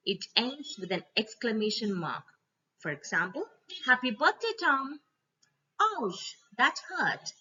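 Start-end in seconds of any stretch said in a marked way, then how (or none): 3.8-5.0 bright, raised birthday cheer; cheerful greeting. Acted. Greeting Tom on his birthday, cheerful. 5.8-7.3 high, yelped ouch of a small hurt; mock pain. Child reacting to getting hurt, mock yelp.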